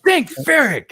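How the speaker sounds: hammed-up joyful exclamation; excited. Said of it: "he gets super excited about it".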